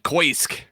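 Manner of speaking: Koisk, swapped vowels, said as koy-sk; mispronounced kiosk. Retold or imitated. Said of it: "I said".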